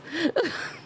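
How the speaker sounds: short high giggle; a quick bright giggle. Real, not acted. Laughing about her accent.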